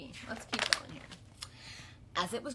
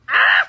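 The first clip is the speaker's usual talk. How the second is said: high, loud bird yelp; startled squawk; 18 dB louder than usual. Acted. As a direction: yelp like a bonked duck. Duck gets bumped.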